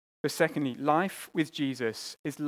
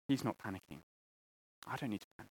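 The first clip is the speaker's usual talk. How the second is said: quiet reassurance; 7 semitones lower, 12 dB quieter, much faster than usual. Retold or imitated. Child's inner reassurance.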